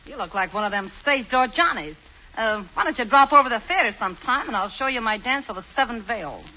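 low, soft brassy dame; gangster's moll. Acted. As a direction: purr it like a tough showgirl. Queenie flirts with Hubert.

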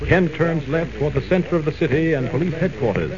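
ordinary talk

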